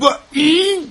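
high scornful question; incredulous, high and scornful; disgusted disbelief. Acted. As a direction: recoil at the idea. Disgust at partnering the doctor.

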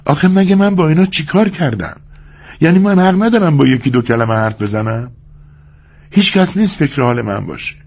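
high-pitched, indignant woman's complaint; angry. A narrator's reading. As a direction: protest heatedly as the aggrieved young woman. (زن خشمگین با صدای زیر و معترض)

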